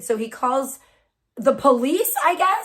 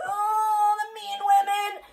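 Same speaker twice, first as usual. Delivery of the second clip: high, whiny fake sobbing; exaggerated boo-hoo, drawn out and mocking; mock crying; 8 semitones higher, slower than usual. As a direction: wail it like a hammed-up crybaby. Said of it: "cried to me in my D Ms".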